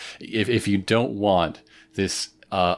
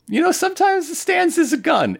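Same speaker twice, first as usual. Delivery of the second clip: high squeaky comic voice; 4 dB louder than usual. Real, not acted. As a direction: squeak it out for laughs.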